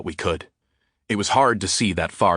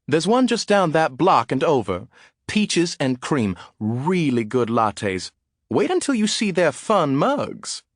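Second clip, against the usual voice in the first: put-on high, slow, sing-song old lady; a sweet gossiping grandma recommending lattes; an old neighbour woman, high and chirpy; 8 semitones higher, slower than usual. A narrator's reading. Seventy-year-old woman chiming in.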